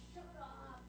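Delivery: high, fast, annoyed scolding; irritated hushing. Acted. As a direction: shoo the noisy beggar away. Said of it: "the disciples go over".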